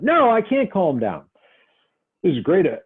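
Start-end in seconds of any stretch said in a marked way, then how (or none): 0.0-1.2 acted angry client. Shown as a demonstration. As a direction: shout back that you can't calm down.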